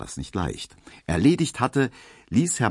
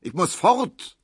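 hohe, ungeduldige Stimme eines cholerischen Kunden; ungeduldiger Markowski; 10 semitones higher, 3 dB louder than usual. A narrator's reading. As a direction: als hastiger Choleriker, der fort muss. Markowski schimpft beim Warten. (ungeduldiger Kunde, höher gesprochen)

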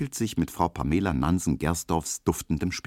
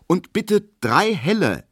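slow, louder, higher, firm order; young clerk firmly ordering three beers for the table; firmly decisive; 13 semitones higher, 5 dB louder than usual. A narrator's reading. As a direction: settle the matter before anyone objects. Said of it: "sagt Emil Kubinke bestimmt und so schnell".

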